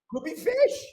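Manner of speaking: high, giggling through the words; can't stop laughing; laughing hard. Real, not acted. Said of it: "I'm laughing so hard".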